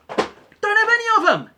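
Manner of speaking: high, very loud outburst; comic full-voice yell; sudden mock shout. Sudden yelled third option after "Or.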